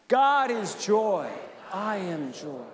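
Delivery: joyful affirmation again. Shown as a demonstration. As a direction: stretch "God" out, let "I am joy" settle. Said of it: "Let's try that one".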